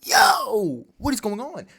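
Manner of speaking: mock panic, climbing high; a drawn-out yo into high bewilderment; freaking out. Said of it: "He's freaking out".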